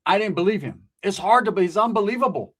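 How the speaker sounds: ordinary talk, nothing marked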